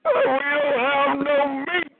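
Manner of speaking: high, mock-whiny complaint; whining people. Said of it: "all these people just crying".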